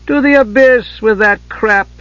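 high, slow, contemptuous dismissal; scornful snort. A narrator's reading. Warrior mother dismissing her son's honor, snorting scorn. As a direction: snort it with disdain, pitched high. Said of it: "Kitiara snorted".

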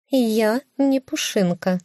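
high offended cat voice. Acted. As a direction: cut in high like a proud cat. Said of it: "перебила меня кошка". (высокий обиженный кошачий голос)

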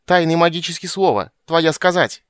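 high, loud, outraged squawk; enraged high-pitched shout; furious cry. A narrator's reading. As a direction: yell it like a small creature in a fury. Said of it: "в ярости вскрикнула Бупу".

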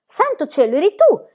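high, loud burst of surprise; exclaiming. A narrator's reading. Pippi hearing Book's cry of pain, surprised exclamation. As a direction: cry out in mock shock. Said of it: "esclamò Pippi".

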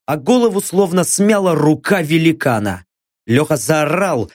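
usual speaking voice

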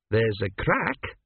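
slow, doubtful observation. A narrator's reading. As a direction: draw it out, not convinced. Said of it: "she said doubtfully".

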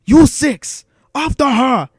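high, loud villainous command; shouted order. Acted. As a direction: shout urgent orders at your troops. Viceroy shouts orders to droids.

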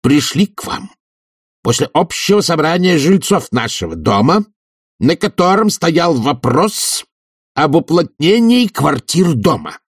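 high, deliberate official statement; hateful. A narrator's reading. Committee head addresses the professor, with controlled hatred. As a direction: state the committee's business with pointed hostility. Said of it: "с ненавистью заговорил Швондер".

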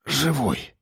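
quiet hoarse report. A narrator's reading. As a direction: rasp it out, cowed and quiet. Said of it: "доложил он сипло".

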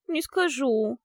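high, whiny sulky reply; whimpering. Acted. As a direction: whimper it from hiding. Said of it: "проскулили из теплицы".

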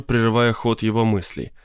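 ordinary delivery, nothing marked

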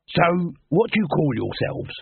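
a pushy merchant sizing up a band; Dibbler voiced. A narrator's reading.

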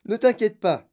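loud, high, brisk brush-off; monsieur Wonka. A narrator's reading. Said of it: "dit monsieur Wonka". (réplique forte et aiguë)

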